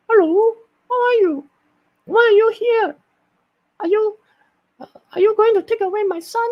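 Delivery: very high squeaky mom voice; Mickey Mouse mom. Shown as a demonstration. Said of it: "mom is gonna be a little bit like Mickey Mouse".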